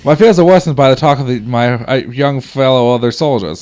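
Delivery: put-on old-timey soldier reading his letter. Soldier writes of frightening nights in hospital, old-timey voice. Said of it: "that's secretly just my old-timey voice".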